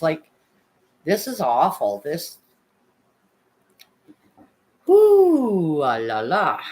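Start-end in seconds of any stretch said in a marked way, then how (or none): 4.9-5.8 overwhelmed whoo. Real, not acted. Overwhelmed by painful story.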